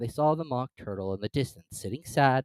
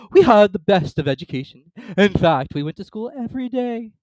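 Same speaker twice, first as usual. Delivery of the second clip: high, loud weepy voice; weepy Mock Turtle; 10 semitones higher, 12 dB louder than usual. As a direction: wail like a sad creature.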